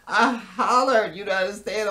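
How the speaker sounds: usual speaking voice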